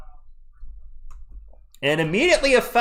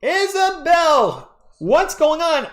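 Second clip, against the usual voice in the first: drawn-out, much higher greeting; excited greeting; 12 semitones higher, 4 dB louder, much slower than usual. Real, not acted. Streamer warmly calls out to viewer Isabel, excited and high. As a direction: stretch the name out high.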